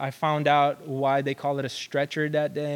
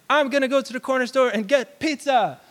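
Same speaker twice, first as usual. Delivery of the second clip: put-on high, louder, thrilled kid announcing his plan; excited kid; 10 semitones higher, 5 dB louder than usual. Hungry kid telling his mom he's getting pizza, excited. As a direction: blurt it out like a kid who just won. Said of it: "I was like".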